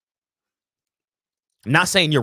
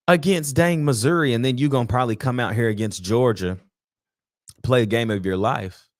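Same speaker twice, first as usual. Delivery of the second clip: usual speaking voice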